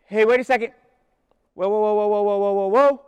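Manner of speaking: hammed-up frantic "whoa" to stop the crowd; preacher parodying a Jesus who backs away from people; mock panicked Jesus. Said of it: "instead of Jesus going".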